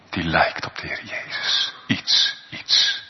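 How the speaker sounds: soft, breathy whisper of awe; hushed whisper. Acted. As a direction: drop to a whisper to draw them in. Preacher stresses likeness to Jesus.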